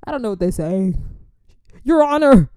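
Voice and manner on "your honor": high, loud courtroom objection; hammed-up objection; mock lawyer. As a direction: play at being a lawyer.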